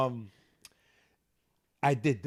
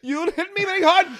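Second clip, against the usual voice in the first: mock wailing; 8 dB louder than usual. Imitating crash victim complaint.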